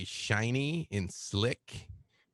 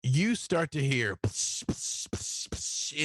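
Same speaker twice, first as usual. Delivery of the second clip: psh sound effects; 4 semitones higher, slower than usual. Retold or imitated.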